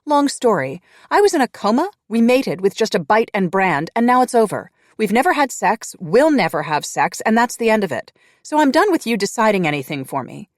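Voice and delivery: high, loud, defiant rant; heated rant. Acted. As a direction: tell him off at full volume. Grace ranting at her mate.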